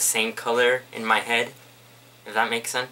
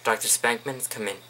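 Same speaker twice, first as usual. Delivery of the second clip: ordinary delivery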